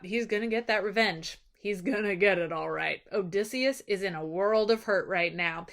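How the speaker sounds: ordinary delivery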